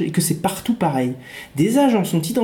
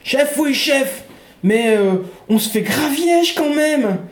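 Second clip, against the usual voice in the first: gung-ho soldier; 6 semitones higher than usual. Acted. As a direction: play the eager simple-minded private. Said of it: "Vous excuserez la scène suivante".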